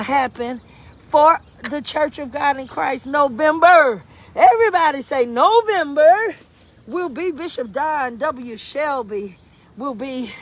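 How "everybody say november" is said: loud, high-pitched call to the crowd; big, ringing announcement; rallying call. Real, not acted. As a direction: get everybody to chant along. Rallying the audience about November.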